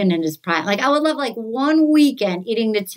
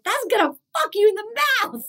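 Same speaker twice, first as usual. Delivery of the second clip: quoting her; 9 semitones higher than usual. Retold or imitated. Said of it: "It's like".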